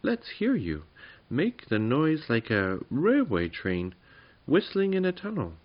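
high, excited little goblin; small creature's keen, high demand; eager little goblin. Acted. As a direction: urge him on, voice up high. Amazed goblin demands a noise.

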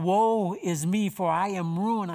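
a mild cry of woe. A narrator's reading. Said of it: "he cried out".

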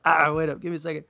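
talking through a tickle in the throat; strained voice from a bug in the throat; just swallowed a bug. Real, not acted. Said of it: "I think I swallowed a bug".

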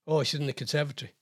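ordinary talk, nothing marked

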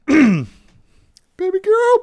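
high, drawn-out gushing; playful high-pitched fawning; gushing pet name. Pretend. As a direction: coo it high, like a smitten fan. Fond squeal at a favourite actress.

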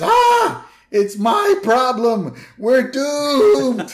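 very high, loud screeching panic; a cartoon dad realizing doom; panicked scream. Homer voiced in panic.

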